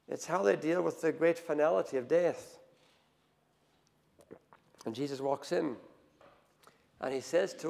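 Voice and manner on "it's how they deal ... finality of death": ordinary talk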